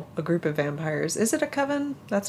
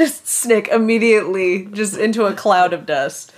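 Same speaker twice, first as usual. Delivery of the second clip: laughing through the words, louder; amused aside; 3 semitones higher, 6 dB louder than usual. Real, not acted. Joking after laughing at the line.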